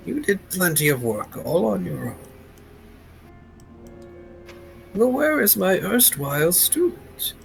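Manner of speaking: a gentle old mentor, lightly voiced; old man voice. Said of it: "Old man voice. Just generic old man voice".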